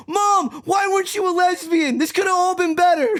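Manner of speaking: hammed-up blaming of his mother; mock accusing yell. Blaming his mom for how he turned out, high mock shout.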